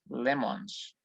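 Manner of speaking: "lemons" said in English with a clear final s; teacher's English demo of "lemons"; English pronunciation. Shown as a demonstration. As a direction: model "lemons" slowly for the class. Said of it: "solo añadimos una S".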